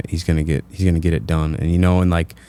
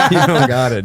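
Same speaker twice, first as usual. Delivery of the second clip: high laughing jab; laughing tease; 7 semitones higher, 3 dB louder than usual. Real, not acted. Cohost mocks friend's New York accent, laughing. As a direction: tease him through laughter.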